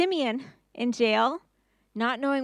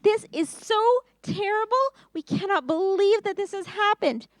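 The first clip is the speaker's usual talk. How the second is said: high, loud crying wail; upset brothers; 10 semitones higher, 5 dB louder than usual. Acted. Brothers find money returned, wailing high and loud. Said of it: "And they were so upset".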